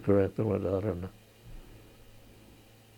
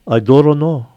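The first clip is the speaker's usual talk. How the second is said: louder, higher Pidgin "I don't know" with the "don't" clipped; Kohala Pidgin; 5 semitones higher, 8 dB louder than usual. Retold or imitated. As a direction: say "I don't know" like a Kohala local, "don't" squeezed short. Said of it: "if you ask a Kohala person".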